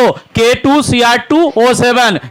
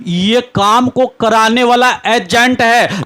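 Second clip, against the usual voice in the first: normal talking voice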